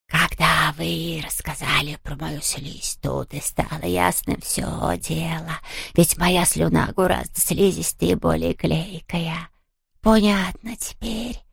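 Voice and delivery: slow, soft whispery ghost; ghostly whisper. A narrator's reading. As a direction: explain it like a smug ghost. Ghost explains. (шёпот привидения)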